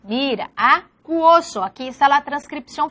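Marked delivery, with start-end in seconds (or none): 0.6-1.6 "acuoso" slowly, broken after the a; "acuoso" drawn out syllable by syllable; syllable division. Shown as a demonstration. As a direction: say a-cuo-so for "acuoso". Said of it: "Dos sílabas".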